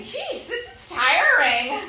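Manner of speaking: mock exhausted groan; weary complaint. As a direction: act worn out. Retold exhausted aside.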